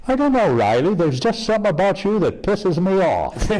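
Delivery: high-pitched answer from above; God's voice, pitched well up. Acted. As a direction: play God replying from the heavens. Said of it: "a voice from above said".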